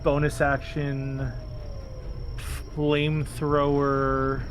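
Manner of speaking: ordinary talk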